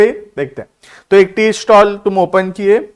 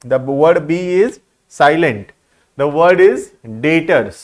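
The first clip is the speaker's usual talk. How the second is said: 1 slow teaching; much slower than usual. Shown as a demonstration. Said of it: "यहां B silent है".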